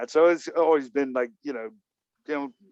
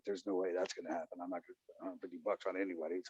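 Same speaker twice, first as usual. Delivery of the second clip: low, fast muttered no-way, much softer; muttered refusal; 6 semitones lower, 16 dB quieter, faster than usual. Retold or imitated. To himself about the retreat price, a low fast mutter. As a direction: brush it off under the breath.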